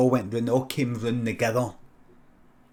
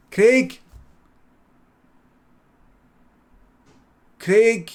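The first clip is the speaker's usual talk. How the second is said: loud, high call into the house; 9 semitones higher, 8 dB louder, much slower than usual. Retold or imitated. Cousins at the door calling for Craig, loud. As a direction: call his name through the open door.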